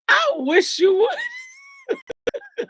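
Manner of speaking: high, drawn-out dare breaking into laughter; loud, squealing mock threat dissolving in laughs; laughing mock dare. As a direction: dare them, then crack up. Laughing dare to a cursing child.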